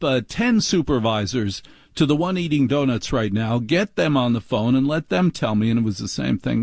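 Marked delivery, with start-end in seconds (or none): none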